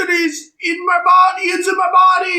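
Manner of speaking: very high, loud mock-panicked cry; over-the-top high yelling; hammed-up possessed shout. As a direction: shriek it like a possessed character. Possessed character shouting, acted out.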